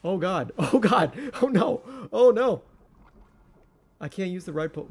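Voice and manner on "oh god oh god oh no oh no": loud high oh no; real gamer panic; panicking. Real, not acted. Panic during fight.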